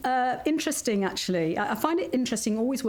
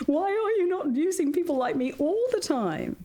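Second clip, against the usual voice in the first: higher, half-laughing mock exasperation; exasperated plea; 7 semitones higher than usual. Voice artist to producers hiring bad voices, mock exasperated. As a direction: protest to the producers, pitched up.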